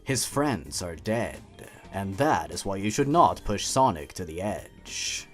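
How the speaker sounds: ordinary talk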